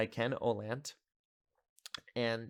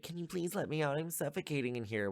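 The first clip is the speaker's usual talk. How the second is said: higher-pitched imitation of a little girl; 6 semitones higher than usual. Trapped little girl asks to be let out, higher mock child voice. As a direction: lightly mimic a pleading child, pitched up. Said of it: "the little girl's like".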